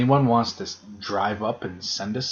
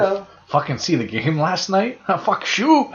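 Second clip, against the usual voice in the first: louder, higher, laughing bluster; a Canadian fan raving about last night's game; worked-up hockey fan; 7 semitones higher, 4 dB louder than usual. Pretend. Co-host jokes as a Canadian fan about last night's game, worked-up and laughing. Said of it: "Easy, calm down".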